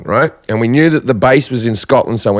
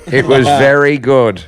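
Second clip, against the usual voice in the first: lightly voiced mum, slow and deliberate; a hint of Mum giving her verdict, stretched out; mum's reply; 3 semitones higher, slower than usual. Comic telling his mum's verdict after the show, slow mum voice.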